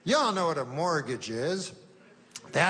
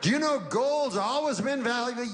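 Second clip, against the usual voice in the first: higher, bright sales pitch read; TV ad pitchman; 8 semitones higher than usual. Retold or imitated. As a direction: sell gold like a TV commercial. Mocking a TV gold ad.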